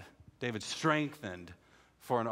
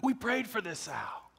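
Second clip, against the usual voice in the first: Kenny amazed; 6 semitones higher than usual. Retold or imitated. Cousin Kenny telling Sal the prayer worked, high amazed voice. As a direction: marvel at answered prayer. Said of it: "He goes".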